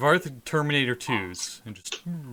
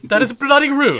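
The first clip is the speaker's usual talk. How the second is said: much higher and louder mock vampire "bloody rude"; mimicking the Baron; 14 semitones higher, 11 dB louder than usual. Player mimics the vampire Baron's swear, hammed-up and loud. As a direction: ham up the vampire's indignant swear. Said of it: "I just like how the Baron swears".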